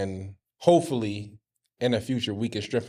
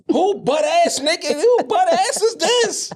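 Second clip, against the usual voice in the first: loud, very high, drawn-out mom voice; imitated outraged mom; 6 dB louder, slower than usual. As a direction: screech it slowly as a shocked mother. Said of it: "She said".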